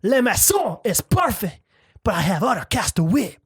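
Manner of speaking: high, hammed-up French accent. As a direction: play a caricature Frenchman calling out. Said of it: "say it like a Frenchman".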